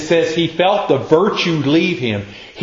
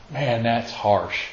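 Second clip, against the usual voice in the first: quiet aside; 5 semitones lower, 8 dB quieter, slower than usual. Real, not acted. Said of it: "speaker's own aside".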